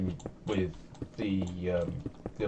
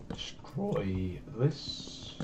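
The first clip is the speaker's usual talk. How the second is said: voice crack; 9 dB quieter, much slower than usual. Real, not acted. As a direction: voice cracking on the words. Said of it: "Ignore the voice crack".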